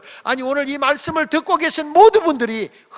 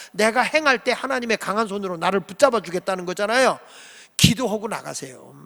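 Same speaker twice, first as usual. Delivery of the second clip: normal talking voice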